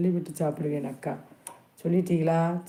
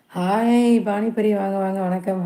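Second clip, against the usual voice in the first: excited greeting; 3 semitones higher, 5 dB louder than usual. Real, not acted. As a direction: stretch the hello and call it out brightly. Greets a newly arrived viewer.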